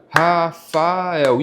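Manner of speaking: "Rafael" drawn out as Ra, fa, el; syllable by syllable. Shown as a demonstration. Pupil practising syllable separation in class, "Rafael" said slowly in three parts. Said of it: "ter essa separação de sílabas".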